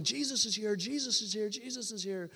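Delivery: excited high cry, fading by the end; retold yelling. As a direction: call it out like a man seeing a vision. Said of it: "their dad was yelling".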